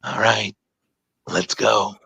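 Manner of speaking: low voice through clenched teeth; through gritted teeth. Retold or imitated. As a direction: squeeze it out through your teeth. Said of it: "talk through your teeth".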